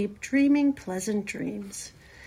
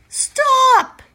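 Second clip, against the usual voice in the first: high, loud shriek to cut someone off; panicked scream; 11 dB louder than usual. A narrator's reading. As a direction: scream it in terror. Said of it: "screamed Wilbur".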